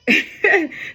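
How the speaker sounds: high bursting laugh. Real, not acted. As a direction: let out a big laugh. Laugh after memory.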